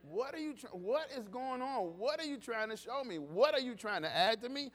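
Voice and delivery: loud, rising gospel preaching, driving each line; full-force preaching. Acted. Pastor urging the congregation to stay in church, loud preaching. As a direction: preach it out to the back pews.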